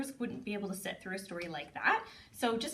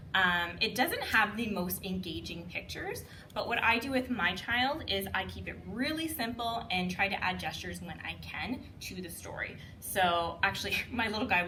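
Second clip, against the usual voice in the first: normal talking voice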